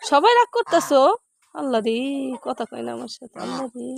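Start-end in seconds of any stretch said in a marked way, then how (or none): 1.5-3.9 low, soft muttering to herself; quiet aside. Real, not acted. To herself about the sulking cow, soft mutter. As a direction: mutter the complaint under your breath.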